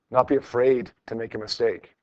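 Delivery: ordinary talk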